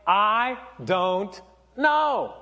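slow, spaced-out words with pauses; serious, drawn-out. Acted. Pastor repeating the emoji's answer in earnest, slow and deliberate. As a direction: let each word land separately, seriously. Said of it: "You know, like, seriously, like".

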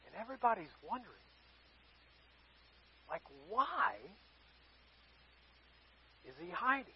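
very soft, low, halting murmur; hushed, with long awkward pauses; hushed wondering. Acted. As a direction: drop to a near-silent puzzled aside. Crowd wondering at awkward moment.

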